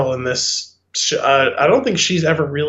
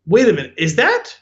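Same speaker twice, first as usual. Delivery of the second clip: sudden realization; 6 semitones higher than usual. Retold or imitated. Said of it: "I was like".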